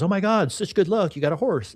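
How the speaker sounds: a hint of the neighbor, thrilled at the luck; excited neighbor. Storyteller voicing the neighbor at the new horse, mildly excited.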